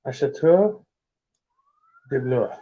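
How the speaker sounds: trying out French words; halting guess at French pronunciation; attempted French. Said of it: "I know it's probably terrible".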